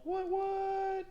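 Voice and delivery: high squeaky double what; tiny cartoon squeak. Hyper art reveal, squeaky voice.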